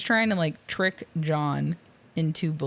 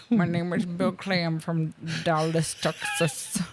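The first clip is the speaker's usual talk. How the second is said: hammed-up bad American accent; a terribly faked Texan-American accent; [name]. [name] claims to be from Dallas, awful American accent. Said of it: "It's the worst American accent I've ever heard".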